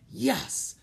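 high private cheer; small triumphant exclamation to yourself; said to himself. A narrator's reading. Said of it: "Jack said to himself".

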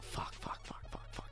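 whispered, very soft string of curses; inner panicked muttering. Retold or imitated. Boy watching the skinning curses in his head, whispered. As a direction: swear under your breath as if only thinking it. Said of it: "in my head".

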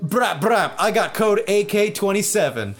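gangster bravado. Retold or imitated. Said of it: "And I was like".

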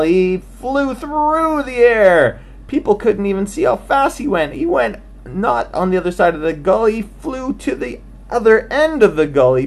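high, swept-up telling; excited narration. A narrator's reading. Narrator tells Frank's huge jump in a high swept-up voice. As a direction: let the flight soar in the voice.

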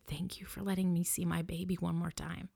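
breathy whispered thanks, barely voiced; hushed thanks. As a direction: whisper it like a grieving mother clinging to you. Grieving mother thanking embalmer, retold.

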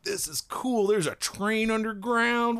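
put-on high, wide-eyed kid awe; childlike wonder. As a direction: gasp at it like an eight-year-old. Child's wonder at Boston subway.

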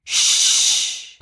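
sharp hush. A narrator's reading. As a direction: shush them. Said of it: "hushed one of the beasts".

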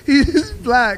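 cracking up mid-sentence; tearful laughing outburst; laughing fit. Real, not acted. Laughing hard at the story.

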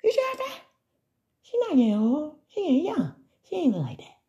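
high, hushed mock gossip; whisper-gossip like a nosy crowd; gossiping fans. Fans gossip about her youthful face, high and hushed.

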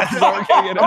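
laughing. Real, not acted. Laughing through the line.

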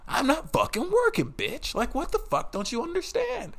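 high-pitched, indignant rant; exasperated retort. Retold or imitated. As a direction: protest in a high, incredulous voice. Retells retort to boss's after-hours demands.

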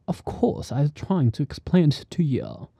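soft, half-whispered attempt at a British accent; a quiet, careful stab at posh British English. Shown as a demonstration. Trying out British English, soft British accent. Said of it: "obvio que no me sale para nada bien".

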